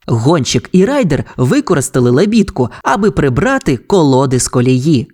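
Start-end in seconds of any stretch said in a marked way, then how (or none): none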